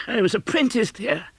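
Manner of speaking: high, astonished little cry; surprised at the question itself; delighted Scrooge. Acted. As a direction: Scrooge recognising his old workplace, delighted. Scrooge continues.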